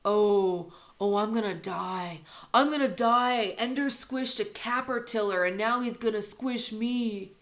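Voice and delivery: sarcastic pretend fright; mock terror. Bully mocking his little brother, hammed-up fear.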